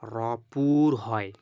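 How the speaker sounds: very slow, deliberate teaching phrase; slow emphasis. Shown as a demonstration. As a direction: spell out each word slowly to the class. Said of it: "অর্থাৎ পুর করে পড়তে হবে".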